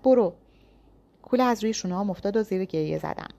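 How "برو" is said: loud high command; shout. A narrator's reading. Yas shoving the narrator away, shouting. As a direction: shout go at her. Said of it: "داد زد".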